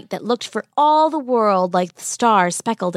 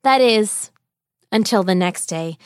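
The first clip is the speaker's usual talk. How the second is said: plain reading voice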